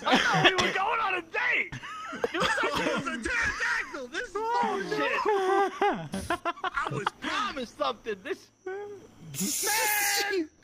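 half-crying, half-laughing rant; hammed-up jilted wail; mock heartbroken rant. Jilted lover mock tantrum.

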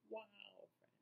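usual speaking voice

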